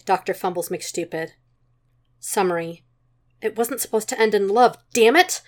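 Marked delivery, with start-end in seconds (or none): none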